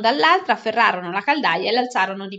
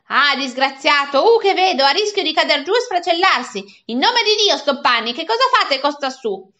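declaiming headmistress; 5 semitones higher, 6 dB louder than usual. Acted. Headmistress squeezes into the cell, declaiming in loud alarm. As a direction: proclaim it like a melodramatic matron. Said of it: "la signora Geltrude declamando".

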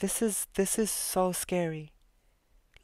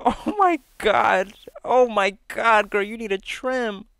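much louder, slow giggly exclaim; laughing while teasing the wolf; 4 semitones higher, 10 dB louder than usual. Real, not acted. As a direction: crack up at the shaggy wolf.

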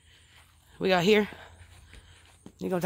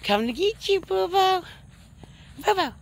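high, teasing singsong for the pup; playful call to dog; 10 semitones higher than usual. Real, not acted.

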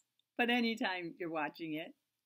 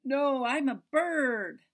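slow, louder little bird reply; 4 semitones higher, 10 dB louder, slower than usual. Acted. Bird answering the seeking cat, slow and louder.

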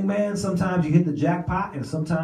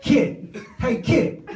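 calling out; 12 semitones higher, much slower than usual. Retold or imitated. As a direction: raise your voice to get her attention. Calling girl across room.

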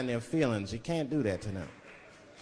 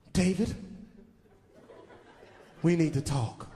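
mock girlfriend. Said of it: "My old lady come up to me".

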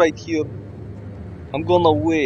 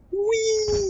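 high gleeful squeal while tossing a body; a giddy high-pitched whee as he throws him; playful whee; 4 dB quieter than usual. Real, not acted. Throwing the guard over the rail, gleeful high squeal.

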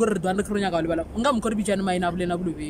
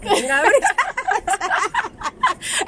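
high giggling laugh; 10 semitones higher than usual. Real, not acted. Name game cracks her up.

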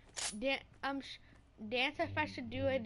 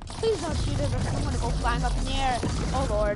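loud pleading; 7 dB louder than usual. Real, not acted. Begging teammate not to shoot.

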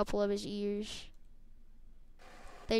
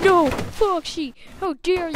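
outraged cry; 8 semitones higher, 7 dB louder than usual. Pretend. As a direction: scream it at the killer. Toy avenges Foxy.

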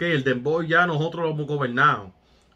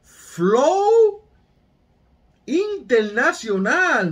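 sudden high, loud shout of "flow"; hyped shout; 10 semitones higher, 5 dB louder, much slower than usual. Real, not acted. Reacting to the track, shouting with excitement. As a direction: blurt it out thrilled, way up high.

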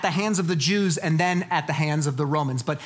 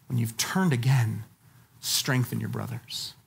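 kindly; 8 semitones lower than usual. Retold or imitated. Jesus kindly warning Peter before his denial, tender and low. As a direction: speak like a loving friend. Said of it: "what Jesus so kindly said to Peter".

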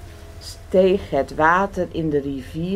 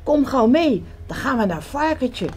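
higher and urgent, hurrying a friend along; calling out; 7 semitones higher than usual. A narrator's reading. Frog urging Duck to come to Piglet, calling. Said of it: "riep Kikker".

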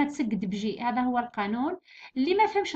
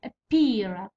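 Appear with a long second syllable; stress on PEAR; 4 semitones lower than usual. Shown as a demonstration. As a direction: hold 'pear' at the end of Appear. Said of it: "ستريس يجي في البي هذه".

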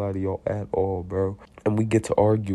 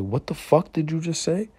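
higher-pitched incredulous challenge; retold confrontation, pitched up without shouting; acted challenge; 8 semitones higher than usual. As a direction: demand it, voice jumping up. Acts out his angry challenge.

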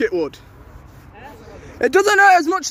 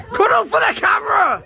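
teasing shout; 6 semitones higher than usual. Real, not acted. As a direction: yell it across teasingly. Calling out teasing.

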